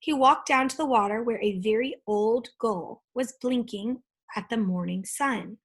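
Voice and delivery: ordinary delivery, nothing marked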